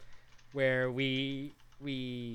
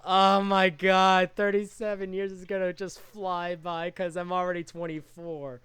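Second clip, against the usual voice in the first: shocked disbelief; 6 semitones higher, 7 dB louder than usual. Retold or imitated. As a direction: act stunned at the years. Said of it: "I was just like".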